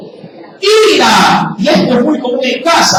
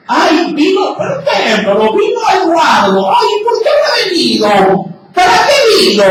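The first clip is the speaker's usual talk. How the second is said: malicious gossip; 7 semitones higher than usual. Retold or imitated. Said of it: "La malicia".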